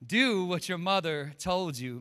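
slow, weighty delivery of the title; preacher's slow pronouncement; slow emphatic title. Shown as a demonstration. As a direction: spell out each word like a commandment. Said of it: "The pastor said".

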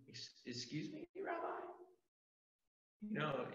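a too-polite beggar shyly asking the rabbi; timid asking. Pretend. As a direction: tiptoe into the question like you're afraid to bother him. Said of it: "Bartimaeus was like".

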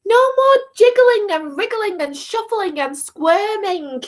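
high, sing-out storybook scolding; shouted telling-off. A narrator's reading. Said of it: "shouted Izzy at the top of her voice".

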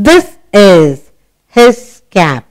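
slow, each word apart; slow reading aloud. Shown as a demonstration. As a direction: read it slowly for a child. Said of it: "Shall we read it together?".

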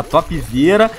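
normal talking voice

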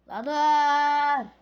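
sudden loud yell to stop; shout. Real, not acted. Shouting at the game to stop.

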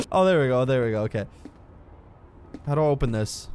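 usual speaking voice

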